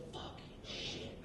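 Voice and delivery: whispered swearing, very soft; hushed, secretive kid whisper; child's whisper. Retold or imitated. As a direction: whisper it like a kid telling on someone. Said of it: "she'll whisper it".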